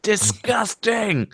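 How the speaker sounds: high, drawn-out mock shouting without real volume; Zarna Joshi. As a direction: stretch each word high, mimicking an outraged woman. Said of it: "you can just hear Zarna Joshi shouting".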